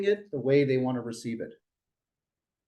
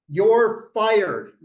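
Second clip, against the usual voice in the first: mock boss firing; 5 semitones higher, 7 dB louder than usual. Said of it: "you know what their favorite word is".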